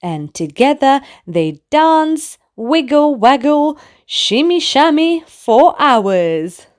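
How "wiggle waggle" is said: plain reading voice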